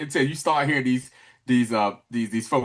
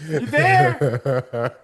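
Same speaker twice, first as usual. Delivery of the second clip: mock call into the distance; 2 semitones lower, 4 dB louder than usual. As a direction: call out to check someone is listening. Calling out to make contact.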